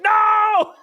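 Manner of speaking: very high, loud wail; hammed-up shriek of protest; mock horror. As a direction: cry out in fake dismay. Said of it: "you're like".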